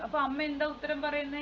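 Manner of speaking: usual speaking voice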